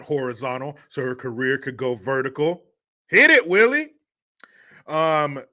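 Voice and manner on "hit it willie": loud cue. Real, not acted. Cueing a sound effect.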